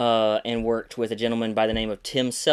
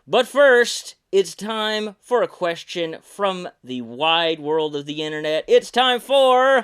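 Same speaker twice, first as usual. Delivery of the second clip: louder, slow, hammed-up announcer build; 6 semitones higher, 6 dB louder than usual. Host introduces the listener question segment, slow booming announcer. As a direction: sell the segment like a game show host.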